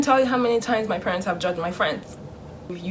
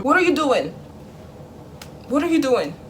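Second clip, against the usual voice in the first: parent's raised-pitch challenge on walking in; mock parental interrogation, higher voice; demanding parent; 6 semitones higher than usual. Parent barging into the child's room, demanding.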